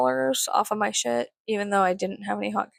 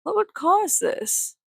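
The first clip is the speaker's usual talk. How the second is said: puzzled; 9 semitones higher, slower than usual. Retold or imitated. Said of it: "I'm just like".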